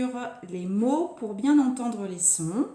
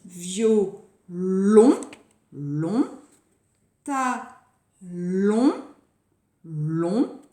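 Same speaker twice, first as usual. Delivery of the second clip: Violon and talon broken apart, then long echoed alone lower; last syllable isolated; 4 semitones lower, 4 dB louder, much slower than usual. Shown as a demonstration. Teacher showing both words share one syllable, last syllable isolated. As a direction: punch LON in Violon and talon, then repeat long by itself.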